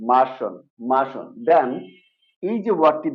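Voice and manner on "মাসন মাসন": "মাসন" as ma-shon, the r silent; correct, silent R. Shown as a demonstration. Teacher corrects the word, "মাসন" as ma-shon. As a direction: drop the r in "মাসন", end on shon. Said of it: "এটার উচ্চারণ হবে".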